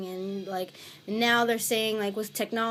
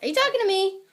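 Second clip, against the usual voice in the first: calling out; 11 semitones higher, 7 dB louder, much faster than usual. Real, not acted. Calling out to another room.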